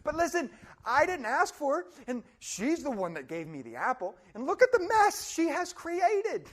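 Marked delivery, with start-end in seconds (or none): 4.3-6.5 exasperated accusation. Retold or imitated. Adam accusing Eve.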